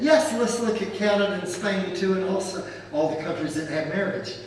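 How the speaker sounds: ordinary talk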